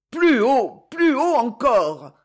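loud, high, straining cry that slows; hoarse urging shout. A narrator's reading. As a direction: rasp out the order from your knees. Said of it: "râla Belphégor".

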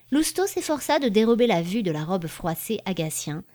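plain reading voice

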